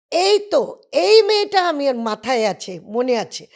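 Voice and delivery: loud, high, delighted Bengali exclamation; excited recognition. Retold or imitated. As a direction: call out happily, like spotting someone remembered. Ray recognises her, excited. (उत्साह से ऊँची, ज़ोरदार आवाज़ में पहचानना)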